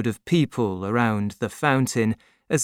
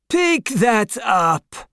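much higher, very slow commanding voice; imperious Marquis; 10 semitones higher, 4 dB louder, much slower than usual. A narrator's reading. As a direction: toss the order down with contempt. Marquis orders.

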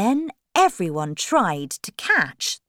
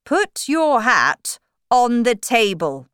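loud, raised commanding voice; storybook king; 6 semitones higher, 7 dB louder than usual. Acted. King ordering the Hatter, loud commanding voice. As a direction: order him firmly and loudly as king. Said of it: "he said to the Hatter".

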